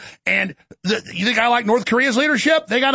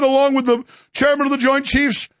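ordinary delivery

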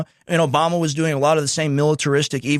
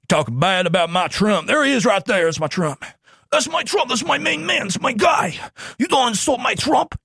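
higher, fired-up mock fan voice; mock Trump fan; 5 semitones higher than usual. Podcaster mocking a Trump fan, high and fired up. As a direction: ham up a devoted Trump supporter. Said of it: "People go".

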